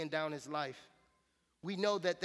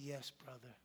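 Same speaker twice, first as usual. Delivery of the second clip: much softer, lower, gently reverent answer; lady's soft reply; 4 semitones lower, 12 dB quieter than usual. Retold or imitated. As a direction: hand it back quietly like a devout sister. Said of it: "She was like".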